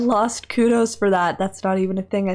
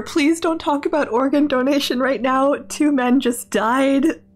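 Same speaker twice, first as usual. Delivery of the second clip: higher, mounting outrage; exasperated scolding; 6 semitones higher, 3 dB louder than usual. Real, not acted. Player scolding a tactless joke after two deaths, exasperated. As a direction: plead in disbelief, climbing to a squeal.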